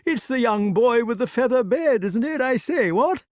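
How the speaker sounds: high, fluting, eager English knight; dotty king's high-pitched exclamation; excitable old-fashioned king. Acted. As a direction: burble it out high in delighted recognition. Voices eccentric King Pellinore.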